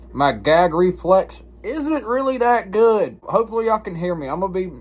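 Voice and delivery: talking through a mouthful; words slurred around a big lip of tobacco; mouth full of dip. Real, not acted. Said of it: "subtitles or something down here if y'all can't really understand me".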